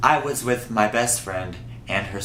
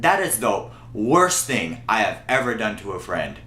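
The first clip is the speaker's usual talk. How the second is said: normal talking voice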